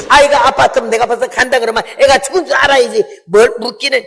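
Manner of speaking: agitated. Pretend. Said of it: "나 같으면 이래야지".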